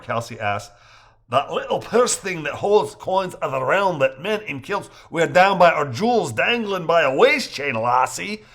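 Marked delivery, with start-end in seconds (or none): 1.3-8.4 put-on raised, rolling Scottish Highlander accent; Scottish accent, teasing the lassie. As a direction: explain it like a Highlander in Scottish brogue. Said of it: "with a Scottish Highlander's accent".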